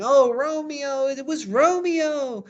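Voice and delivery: slow, very high, loud wail; theatrical sobbing-style cry, badly overdone; hammed-up crying nurse. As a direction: draw out a mock lament. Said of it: "she's crying and she's like".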